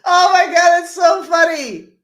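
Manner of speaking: real laughter, loud and high; can't get through it for laughing; squealing with laughter through the words; laughing hard. Real, not acted. Cracking up at own joke.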